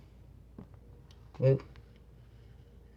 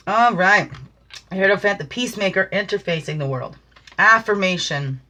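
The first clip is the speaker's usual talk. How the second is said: usual speaking voice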